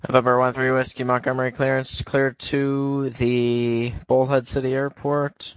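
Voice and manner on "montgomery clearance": normal talking voice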